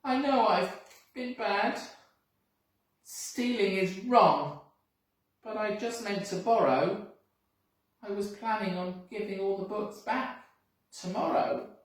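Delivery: mild tearful apology; sniffly apology. Acted. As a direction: plead sorry like a guilty child. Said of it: "he sniffled".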